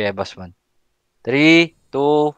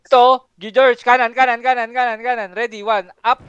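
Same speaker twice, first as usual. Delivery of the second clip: raised, high-pitched command; urgent callout; 8 semitones higher than usual. Real, not acted. Leader steering the squad before a move, urgent high callout. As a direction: rattle off the direction high and insistently.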